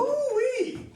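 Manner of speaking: high, loud whooping Ooh-wee; excited whoop. Acted. Huck whoops at Becky's news of eleven grandchildren. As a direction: whoop in delight.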